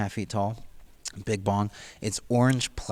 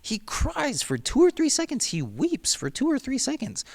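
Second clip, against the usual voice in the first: high-pitched, hammed-up weepy wail; mock bawling; 9 semitones higher than usual. Reader retelling a man's bathroom breakdown, mock bawling. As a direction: imitate someone bawling, pitched way up. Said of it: "he just starts bawling".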